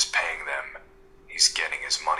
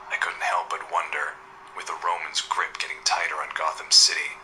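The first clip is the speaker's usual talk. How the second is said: ordinary delivery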